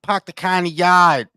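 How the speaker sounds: drawn-out Boston vowels, no Rs; Boston accent. Shown as a demonstration. Copying a friend's accent demo, slow Boston accent. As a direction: echo it slowly in a Boston accent. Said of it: "What about Boston?".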